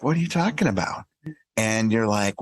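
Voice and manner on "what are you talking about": put-on kid voice. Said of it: "They're like".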